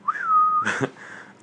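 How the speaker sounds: high, pure whistled note; mock-innocent high whistle; casual whistle. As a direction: whistle as if acting all casual. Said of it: "like, whispering".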